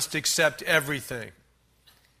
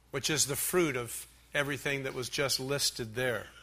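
ordinary talk

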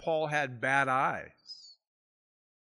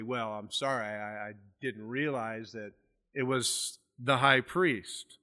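lower, softer, understated sarcasm; sarcastic apology; 4 semitones lower, 5 dB quieter than usual. Retold or imitated.

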